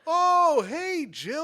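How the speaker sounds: high, drawn-out mock welcome; imitated gushing greeting. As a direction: gush it, mocking their eagerness. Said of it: "Jill walks in and they go".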